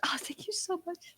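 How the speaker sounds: high, soft whispered thanks; fake tearful whisper. Noblewoman thanking her host for tea, fake tearful. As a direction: whisper it through put-on tears. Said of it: "wiping fake tears off".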